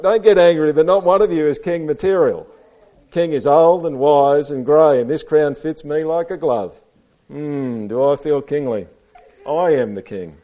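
animated read-aloud pomp; self-important elephant. A narrator's reading. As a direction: reason grandly, then claim the throne. Reads Elephant's speech to children.

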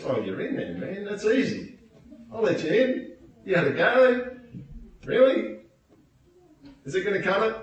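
1 [name] mocking.

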